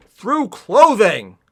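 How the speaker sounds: loud, drawn-out exclamation; exasperated outburst; incredulous. Real, not acted. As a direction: stretch it out in loud disbelief. Incredulous at the book.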